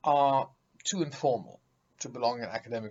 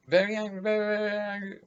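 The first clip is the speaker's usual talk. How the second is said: mock parroting; 7 semitones higher, 4 dB louder, much faster than usual. Mocking the repeated very.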